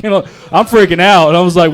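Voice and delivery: ordinary delivery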